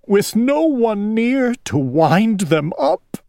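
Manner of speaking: high, weepy owl voice; plaintive, as tears keep falling; crying. A narrator's reading. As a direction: lament it in a high tearful voice. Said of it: "Owl was crying".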